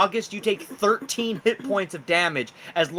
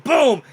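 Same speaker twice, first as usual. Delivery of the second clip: loud boom sound effect; 9 semitones higher, 7 dB louder than usual. A narrator's reading. GM voicing the crash of the fall, loud boom. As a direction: shout boom high and loud.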